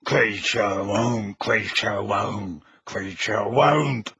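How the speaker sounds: low, slow croaking elf voice; croaking house elf. A narrator's reading. House elf croaking his refusal at the wizard. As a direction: croak it like a stubborn old elf. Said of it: "croaked the house elf".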